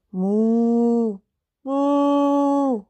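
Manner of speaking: drawn-out mock cow moo; imitated cow moo. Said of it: "Y escuché una vaca". (mugido de vaca imitado)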